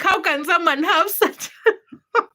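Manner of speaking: giggling in amazement; laughing child. Retold or imitated. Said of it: "I was like".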